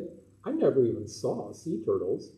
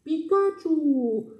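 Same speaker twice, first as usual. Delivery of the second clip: very high, slow, loud squeaky cry; Pikachu; 7 dB louder, much slower than usual. Retold or imitated. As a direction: stretch it like the cartoon creature's cry. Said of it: "my buddy Pikachu came by and said".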